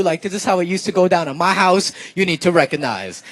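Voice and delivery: ordinary talk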